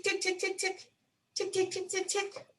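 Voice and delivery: high, soft ticking sounds; sewing machine ticks. A narrator's reading. Narrator imitating mommy's sewing machine, soft and high. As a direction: tap out the machine's rhythm lightly. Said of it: "The sewing machine goes tick".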